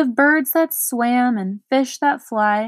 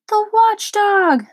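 high, loud, slow terrified cry; frightened shout; 9 semitones higher, 5 dB louder, slower than usual. Acted. Lothargian panics at the watchdog's name, shouting. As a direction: yell it out in fright, nearly fainting. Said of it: "shouted another".

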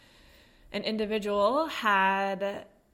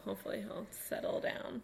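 whispering; 8 dB quieter than usual. Real, not acted. Quiet aside about barking dog.

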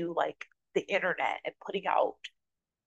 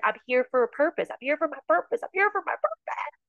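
retold affirmation, climbing higher with each repeat; rising insistent declaration; 9 semitones higher than usual. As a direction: build from raised pitch to very high, insistently. Acting out building self-affirmation to God.

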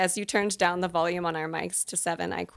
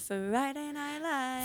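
dramatic announcer; 7 semitones higher, 6 dB quieter, much slower than usual. Pretend. Dramatic show title announcement.

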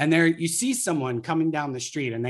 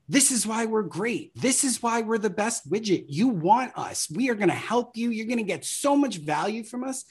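higher, eager brand pitch; boastful marketing pitch, pitched up; pushy sales pitch; 7 semitones higher than usual. Retold or imitated. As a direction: sell yourself a bit too hard.

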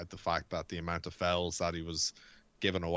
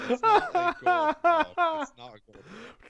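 high laughing delivery through giggles; amused giggling disbelief; 9 dB louder than usual. Real, not acted. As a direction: say it while cracking up. Laughing at Sancho's accent.

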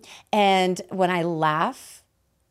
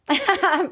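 high raspy cackling laugh; high cackle; 13 semitones higher, 6 dB louder than usual. Real, not acted. Laughs at bizarre answer.

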